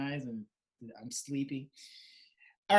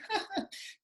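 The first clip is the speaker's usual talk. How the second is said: imitated laugh; 5 dB quieter than usual. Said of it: "she's laughing".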